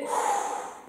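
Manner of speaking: voiceless puff of air; breath out. Shown as a demonstration. Coach shows letting go, voiceless exhale. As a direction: let the breath go in a sigh. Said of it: "On va d'abord expirer".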